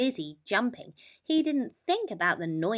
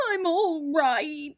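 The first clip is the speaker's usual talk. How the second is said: slow, high sobbing; 8 semitones higher, 9 dB louder, much slower than usual. A narrator's reading. Mole sobs to his friends, slow and tearful. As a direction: choke it out through tears. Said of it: "sobbed Mole".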